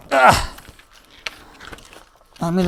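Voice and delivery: usual speaking voice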